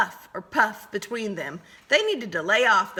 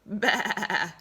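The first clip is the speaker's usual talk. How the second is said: higher, slow sly question; sly wolf voice; 5 semitones higher, much slower than usual. Acted. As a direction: draw it out slyly. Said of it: "asked Willie of Wally".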